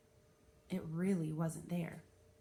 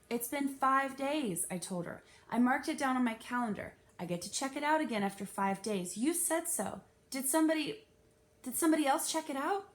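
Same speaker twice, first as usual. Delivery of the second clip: put-on upset child; 5 semitones higher, 4 dB louder than usual. A narrator's reading. Girl pleads, upset.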